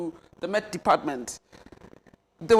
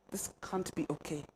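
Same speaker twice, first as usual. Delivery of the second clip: much softer, almost to herself; hushed dismay; 4 semitones lower, 13 dB quieter than usual. Retold or imitated. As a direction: let it fade in disbelief. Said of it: "And I'm like".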